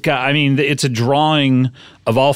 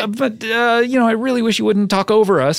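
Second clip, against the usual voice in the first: high, sweetly passive-aggressive; sugary mock forgiveness; 8 semitones higher than usual. Host chiding a guest for talking over them, high and sugary. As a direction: scold politely in a raised voice. Sugary scolding in pretend show.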